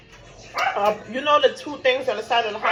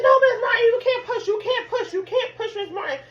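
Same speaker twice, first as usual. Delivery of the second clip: high, insistent mock nurse voice pleading her not to push; imitating the nurses; 12 semitones higher than usual. Nurses telling Miss Martin not to push, high and insistent. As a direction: play the nurse begging over and over, laughing through it. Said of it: "They're like".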